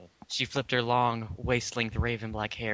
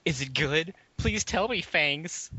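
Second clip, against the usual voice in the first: begging author; 6 semitones higher, 5 dB louder, slower than usual. A narrator's reading. Author begging readers in note.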